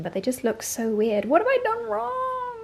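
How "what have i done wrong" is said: mock whine. Baking fail, mock whine.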